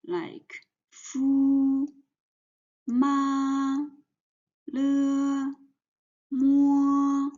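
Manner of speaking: fu, ma, le, mo held high and level; flat first tone. Shown as a demonstration. Teacher showing the first tone, high and level. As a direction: keep each syllable high and flat. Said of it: "the first tone is flat, straight".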